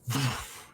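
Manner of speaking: imitated heavy whooshing gusts; a huge creature's wingbeats, done vocally; hefty whoosh. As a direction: make two big breathy whooshes with your mouth. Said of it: "a very hefty, like".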